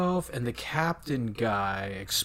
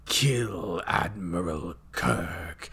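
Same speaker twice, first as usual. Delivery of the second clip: low, slow villain command; Khan; 6 semitones lower, 3 dB quieter, slower than usual. Retold or imitated. Villain ordering the captain to kill, slowly menacing. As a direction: give a drawn-out, menacing order, pitched down.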